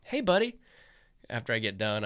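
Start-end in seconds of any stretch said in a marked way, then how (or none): 0.0-0.6 much higher, sing-song innocent call; fake friendly call. Luring his friend to the bathroom, sing-song and innocent. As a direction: lure him in sweetly. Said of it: "I was like".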